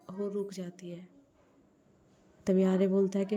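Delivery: usual speaking voice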